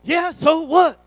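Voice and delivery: high, loud mock-defiant outburst; imitated angry teen. Son challenged about trouble with coaches, imitated angry outburst. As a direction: blow up like a cornered teenager. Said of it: "he's all angry".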